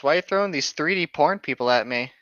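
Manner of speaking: ordinary talk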